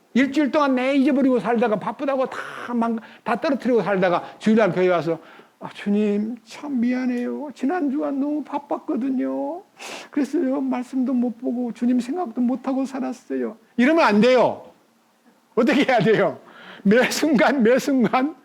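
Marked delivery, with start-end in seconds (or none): 5.6-13.6 sheepish apology. Acted. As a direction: plead a mock apology, dragging the endings. Acted Sunday apology to God.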